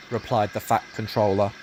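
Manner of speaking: usual speaking voice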